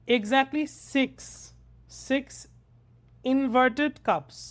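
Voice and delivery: very slow, a few words at a time; slow careful reading. Shown as a demonstration. Teacher showing students how to read for understanding, slowly, piece by piece. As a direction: break the phrase up with long pauses to take it in. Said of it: "ধীরে ধীরে বুঝে বুঝে পড়ব".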